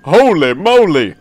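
slow, loud swooping character voice; hammed-up game character; put-on character voice. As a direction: overplay a game character's surprise. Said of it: "give this guy a fitting voice".

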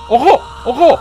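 high, loud warning cries; alarmed shout. Real, not acted. Player watching a car catch fire, high alarmed shouts. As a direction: shout it sharply, twice over.

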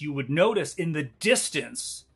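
ordinary talk, nothing marked

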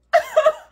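loud high-pitched cackle. Real, not acted. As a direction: burst out cackling.

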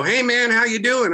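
a hint of a Philadelphia guy saying hi; Philly greeting. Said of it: "people in Philadelphia go".

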